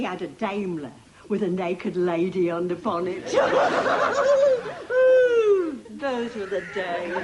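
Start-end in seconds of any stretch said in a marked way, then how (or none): 3.3-5.9 loud cackling laugh. Acted. Grandmother recalls her rich suitor.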